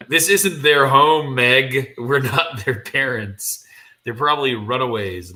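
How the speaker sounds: loud, slow insistence; emphatic husband. Acted. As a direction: spell it out forcefully to a wife. Jim argues firmly with wife.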